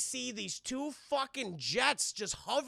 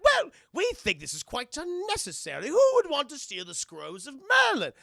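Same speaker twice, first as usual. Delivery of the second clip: higher, louder haughty toffs; snooty British couple; 5 semitones higher, 8 dB louder than usual. Acted. Plumbottoms scoffing at the guards, high and loud. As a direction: sniff at the whole idea.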